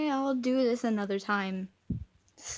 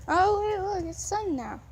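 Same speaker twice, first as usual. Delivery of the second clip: surprised delight; 8 semitones higher than usual. Real, not acted. Seeing the sun come out, suddenly surprised. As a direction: catch it with a jolt of surprise.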